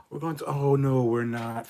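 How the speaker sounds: high, loud, hammed-up backtrack; mock loud reversal. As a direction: swell up, then take it all back. Mock team abruptly reversing itself.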